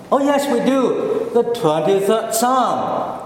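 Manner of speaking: high, eager voice of a self-satisfied churchgoer; mock churchgoer boasting about one psalm; imitating proud churchgoer.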